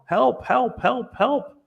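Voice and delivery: high, pleading cries for help, rising each time; desperate high help calls; screaming for help. Retold or imitated. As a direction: beg to be pulled out, pitch climbing. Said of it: "The guy's screaming".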